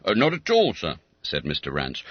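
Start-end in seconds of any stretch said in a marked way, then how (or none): 0.0-0.9 lightly voiced constable. Constable speaks.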